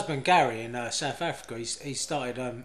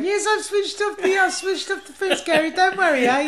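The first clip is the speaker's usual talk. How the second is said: very high mock old-lady voice; sing-song high impression of an old woman; dotty old dear; 12 semitones higher than usual. Old woman reassuring the fence fitter, high dotty voice. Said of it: "this old dear. She was completely mad".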